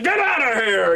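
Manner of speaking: mock furious yell. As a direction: act out chasing off a pest. Sakazuki throws staplers at Sengoku.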